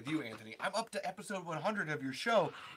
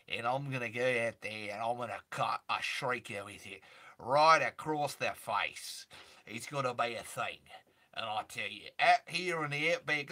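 low, drawling Aussie outback voice; Australian bushman impression; 4 semitones lower than usual. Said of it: "oh, you weren't asking for an impression".

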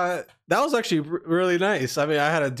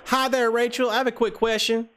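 higher, put-on Southern caller voice; Southern accent; 8 semitones higher, slower than usual. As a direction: prank call in a country accent. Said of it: "do the same Southern accent".

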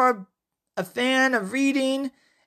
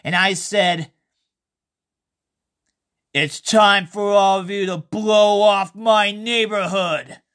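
story narration; 4 semitones lower than usual. A narrator's reading. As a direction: tell the tale unhurried and low. Reads his horror story aloud.

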